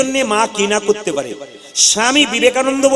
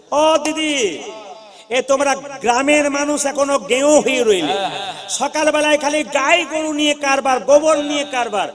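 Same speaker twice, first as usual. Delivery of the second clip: higher-pitched mock city girl calling out; haughty visitor's voice, pitched up; scornful city girl; 4 semitones higher than usual. City girl calls to village women at their cow work, scornful.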